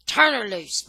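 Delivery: loud commanding holler; mother hollering at her son to let go. Acted. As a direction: bark it at the boy.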